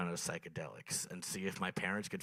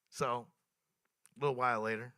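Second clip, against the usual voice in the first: usual speaking voice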